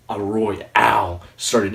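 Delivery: ordinary delivery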